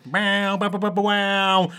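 goofy wow chant; silly clown. Pretend.

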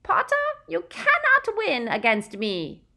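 high, loud cry. A narrator's reading. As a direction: cry it out fiercely. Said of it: "she cried".

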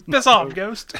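high-pitched Korg quote amid laughter; Korg impression. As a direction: repeat the catchphrase in a high mock voice. Repeats Korg quote between laughs.

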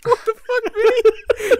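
ordinary delivery

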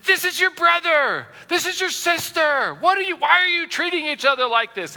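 high, loud, reproachful telling-off; exasperated scolding. Retold or imitated. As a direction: scold squabbling siblings in dismay. Acted parent scolding squabbling children.

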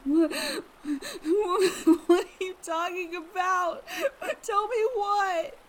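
high, tearful questions; frightened pleading. Acted. Amanda begging her neighbour to explain, scared. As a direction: demand answers through panic.

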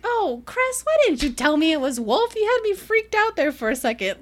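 high, loud retold complaint; exasperated reproach. Man to Cress after being freaked out, exasperated reproach. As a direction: play mock indignation, pitched well up.

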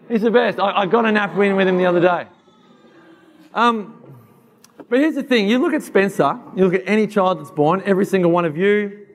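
ordinary talk

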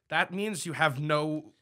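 ordinary talk

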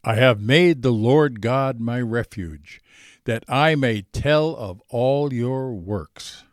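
ordinary talk

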